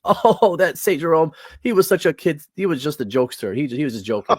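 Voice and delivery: high, loud imitation of brushing it off; mock dismissive. Guest retelling a priest's answer about Jerome, mock dismissive. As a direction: mimic someone laughing off a serious point.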